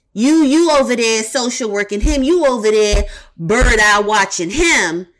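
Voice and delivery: normal talking voice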